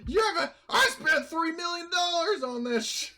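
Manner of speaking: high, loud hammed-up tantrum; screaming and raging. Captain raging over three million lost, hammed-up screaming fit. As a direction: rage as if banging your fist. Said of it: "screaming and raging".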